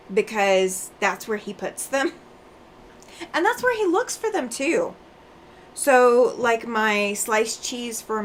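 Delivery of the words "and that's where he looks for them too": amused high squeal. Real, not acted. Laughing about husband's habit.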